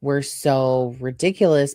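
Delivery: normal talking voice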